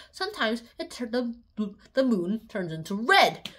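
usual speaking voice

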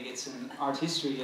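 ordinary talk, nothing marked

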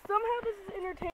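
quietly amused at her mom's fear, said fast; quick amused aside. Real, not acted. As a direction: toss off "Somehow this is entertaining" quickly with "Somehow" stressed. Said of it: "Trust me, I'm entertained by this".

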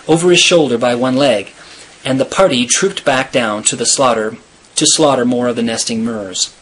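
ordinary delivery

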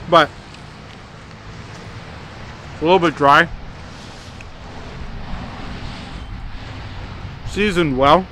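talking between burger bites; speaking with food in mouth; mouth full, chewing. Real, not acted. Seen 2: Eats burger throughout; takes bites mid-phrase, food visible in mouth.

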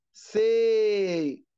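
سي as a long, held "say". Shown as a demonstration. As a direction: draw out the "ay" in سي. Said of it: "أنا بمد في الإيه". (مدّ الإيه في «سي»)

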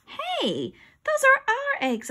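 put-on high-pitched indignant kid; indignant protest. A narrator's reading. Kid claiming found eggs, indignant protest. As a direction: protest your claim loudly.

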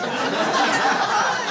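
sudden loud cracking-up laugh. Real, not acted. Bursts out laughing mid-quote.

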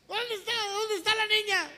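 high, strained demand; squealing, agitated questioning; alarmed father. Acted. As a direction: press for an answer, voice rising to loud. Father demands his daughter.

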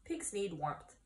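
ordinary delivery, nothing marked

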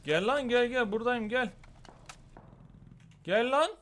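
high, loud come-on; taunting call. Real, not acted. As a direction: dare the enemy over. Taunting enemy.

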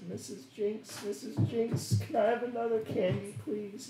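put-on high little child's voice asking; small child. Child tugging Jinx's coat for candy, high pleading voice. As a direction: beg sweetly like a small kid.

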